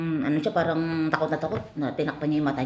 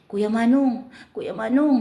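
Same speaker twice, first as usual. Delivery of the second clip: high, louder cry of his name; girl crying out; 8 semitones higher, 3 dB louder, slower than usual. Acted. The girl, carried away, cries out to Kuya Manong in a high voice. Girl calls out during act.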